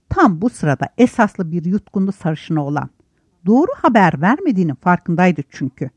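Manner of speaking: plain reading voice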